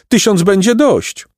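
high, pleading maidens; theatrical weepy plea; tearful plea. A narrator's reading. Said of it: "zapłakały dziewice".

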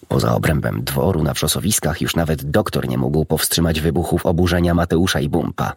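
usual speaking voice

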